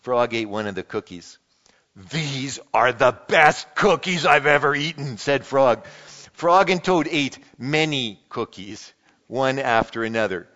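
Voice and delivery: lively read-aloud with voiced characters; animated picture-book reading; storybook reading. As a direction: read it like a children's story to the congregation. Reads Frog and Toad aloud.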